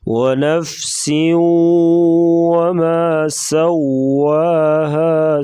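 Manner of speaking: slow ونفس with hissing س and rounded lips on و; tajweed recitation. Shown as a demonstration. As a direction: chant it slowly, lips rounded on واو. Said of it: "سین سی ٹی جیسی آواز نکالیں گے اور واو کو پڑھتے وقت ہونٹ".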